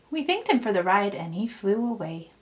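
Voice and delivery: plain reading voice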